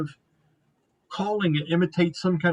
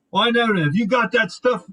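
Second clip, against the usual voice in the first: raised, higher gruff-worker call; lightly voiced half-orc, louder; 5 semitones higher, 4 dB louder than usual. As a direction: holler a question while busy hauling.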